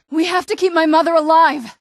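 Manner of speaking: high, loud desperate plea; urgent outcry. Acted. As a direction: cry it out to save her. Urgent plea to save mother.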